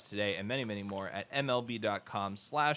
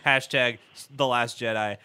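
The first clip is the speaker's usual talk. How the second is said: laughing dictation; 8 dB louder than usual. Shown as a demonstration. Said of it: "okay, here's a tweet".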